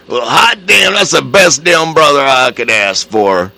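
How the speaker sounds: loud, high, gleeful exclaim; delighted husband. Retold or imitated. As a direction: whoop with joy over the money. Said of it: "he just smiled".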